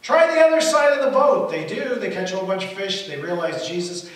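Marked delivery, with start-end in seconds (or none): 0.0-1.5 loud, high shouted instruction; yell from shore. Acted. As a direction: call it out across the water. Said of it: "voice yells from the shore".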